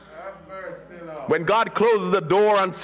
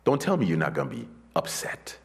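hushed whisper, unvoiced; 6 dB quieter than usual. Preacher whispering to the congregation about a broken relationship. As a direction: confide it in a whisper.

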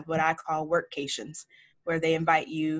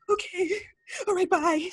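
much higher, timid squeak; meek little reply; 13 semitones higher than usual. Retold or imitated. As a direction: shrink away like a scolded kid. Meek reply to scolding mentor.

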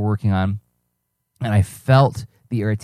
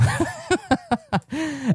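very high, soft laughter; real laugh; 7 dB quieter than usual. Real, not acted. Having decided to fill up more time, laughing. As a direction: crack up softly at yourself. Laughs at his own decision.

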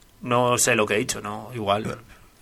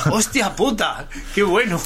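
excited; 5 semitones higher, slower than usual. Real, not acted. Laughing exclamation at joke.

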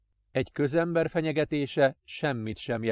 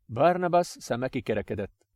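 ordinary talk

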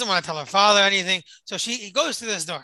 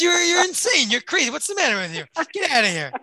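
higher, louder outraged protest; exasperated customer; 8 semitones higher, 3 dB louder than usual. Retold or imitated. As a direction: throw up your hands at the crazy price. Customer yells at overpriced girl.

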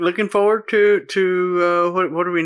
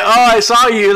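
laughing; 6 semitones higher, 9 dB louder than usual. Retold or imitated. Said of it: "They're like".

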